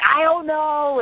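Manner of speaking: high, drawn-out mock exasperation; imitating worked-up boyfriend. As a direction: imitate him getting all worked up, pitch up. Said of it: "so mad and he starts".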